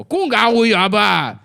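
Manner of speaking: high, loud, slow made-up Chinese; hammy nonsense Chinese, stretched out; fake Chinese. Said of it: "I speak fake Chinese".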